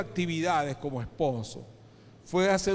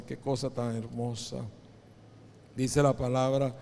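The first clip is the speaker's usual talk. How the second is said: ordinary delivery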